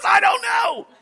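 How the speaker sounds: high, loud put-on wife voice; wife's mock reply. Wife imitated answering her husband with a high hammed-up shrug. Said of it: "She goes".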